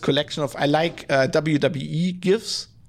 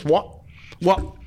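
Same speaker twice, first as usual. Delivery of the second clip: short, punchy barked question; quick mock-challenging interjection; Stone Cold catchphrase; 3 semitones higher than usual. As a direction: fire it off as a wrestler's catchphrase. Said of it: "Stone Cold just goes".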